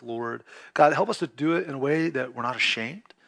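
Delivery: earnest soft-spoken prayer; pastoral prayer. Real, not acted. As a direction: pray over the congregation, hushed and low. Pastor leads congregation in prayer.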